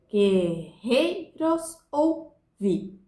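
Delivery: very slow "Guerreiros ouvi", each syllable apart; "Guerreiros ouvi" broken into drawn-out beats; poetic syllable count. Shown as a demonstration. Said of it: "conta-se até a última sílaba tônica".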